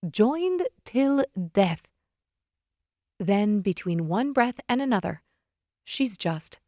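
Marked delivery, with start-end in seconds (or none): 0.1-1.8 slow. Acted. Ominous slow pronouncement.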